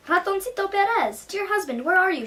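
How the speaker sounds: high, loud calling through the house. Acted. Wife searching the silent house, calling out loud. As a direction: call for him across the rooms. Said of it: "she called".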